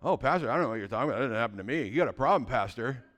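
quick, low singsong denial; teasing imitation of a denying listener; mock innocent congregant. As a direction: rattle it off like a churchgoer feigning innocence. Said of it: "you guys are a bunch of liars".

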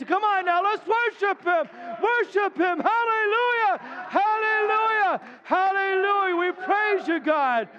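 high, loud, stretched-out worship cry; preaching at full force. Real, not acted. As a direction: lift the room into praise, full voice.

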